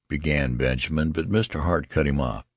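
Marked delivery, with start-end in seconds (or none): none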